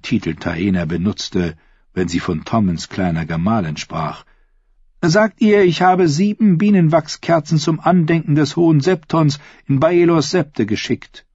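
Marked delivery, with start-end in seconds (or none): 5.0-11.3 high, brisk-spirited royal instruction; scheming queen. A narrator's reading. Queen briefing her lady-in-waiting, scheming. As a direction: dictate the message in a lifted voice.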